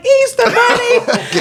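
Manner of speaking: put-on high slow child's call. Little girl calling for the bunny, high and drawn out. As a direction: call down the street for him.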